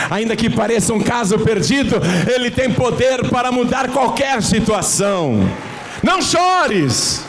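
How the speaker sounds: fervent preacher building to a shout; rising preached shout. Acted. Sermon climax building to shout.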